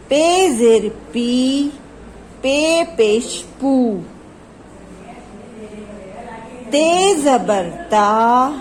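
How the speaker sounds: put-on slow, measured recitation for children; slow phonics drill. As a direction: chant each syllable for kids to repeat. Teacher drills syllables slowly.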